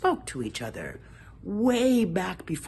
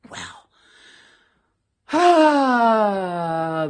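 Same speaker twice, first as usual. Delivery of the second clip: ordinary delivery, nothing marked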